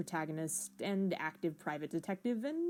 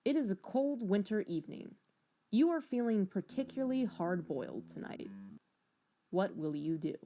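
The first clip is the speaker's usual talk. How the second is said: measured hard-boiled narrator read; noir game narration; 3 dB quieter than usual. Acted. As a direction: set the scene like a detective novel.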